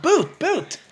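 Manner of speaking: high, insisting boot, boot; insistent repeat. Retold or imitated. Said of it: "I said".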